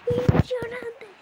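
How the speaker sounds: high, loud excited yell; shouting. Real, not acted. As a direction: belt it out with excitement.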